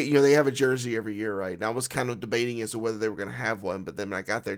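ordinary talk

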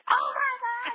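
very high astonished squeak; sudden high surprise; shocked gasp. Retold or imitated. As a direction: squeak it out in stunned disbelief. Hearing she won in class.

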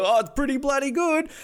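high, surprised mock voice of Justin; a high impressed Justin, tasting steak; voicing Justin. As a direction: squeak it out, pleasantly shocked. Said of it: "And then Justin's like".

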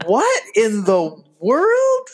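high, slow, drawn-out bafflement; baffled. Retold or imitated. Watching the edited film on the plane, high slow disbelief. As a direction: stretch it out in mock disbelief. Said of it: "And I am like".

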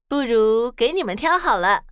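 ordinary delivery, nothing marked

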